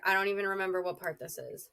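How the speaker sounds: ordinary delivery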